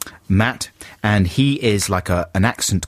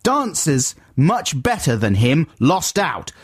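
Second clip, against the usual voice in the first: mock shouting; 9 semitones higher than usual. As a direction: read an angry letter as if in capitals. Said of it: "it says in brackets, "shouting".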